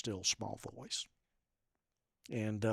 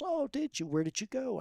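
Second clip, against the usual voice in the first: surprised delight. Retold or imitated. Nurse surprised at Jamaica mention.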